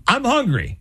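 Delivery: high-pitched whiny complaint; mock hungry woman.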